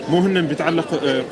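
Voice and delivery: usual speaking voice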